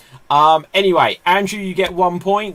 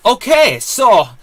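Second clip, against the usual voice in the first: bad Israeli accent; 6 semitones higher, 9 dB louder than usual. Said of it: "my best Israeli accent and it's not good".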